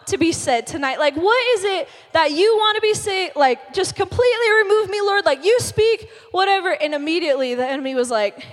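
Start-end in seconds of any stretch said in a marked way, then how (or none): 0.1-6.7 raised-pitch plea to God; earnest praying. Retold or imitated. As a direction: pray with urgency, voice lifted. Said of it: "like, praying about it".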